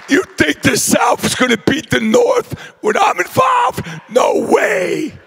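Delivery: brash crowd-pumping shout; excited boast. Real, not acted. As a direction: rile up the room.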